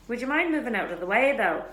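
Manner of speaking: sneering schoolboy, cool and disdainful; lightly voiced sneer, quick and cold; cold drawl. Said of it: "came Malfoy's cold drawl".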